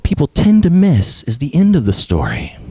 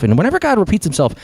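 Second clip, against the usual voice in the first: usual speaking voice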